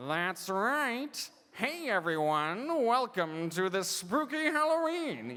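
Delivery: slow, high Skeletor-like villain voice; Death. Shown as a demonstration. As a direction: draw it out like a spooky cartoon skeleton. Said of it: "my way of getting into character".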